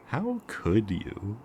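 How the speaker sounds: high, drawn-out fake dismay; mock reproach. Mocks victim for friend-zoning hero.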